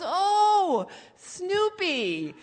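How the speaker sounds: high, long dawning oh; sudden realization. Retold or imitated. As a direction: act out mom finally getting it. Mom finally understands.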